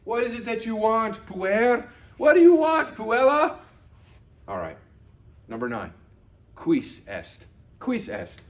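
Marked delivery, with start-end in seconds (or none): 0.0-3.6 much higher and louder, fed-up mother voice; exasperated mom. Acted. Mom answering a whining child, exasperated and loud. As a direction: play the mom losing patience with a whiny kid. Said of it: "she might say".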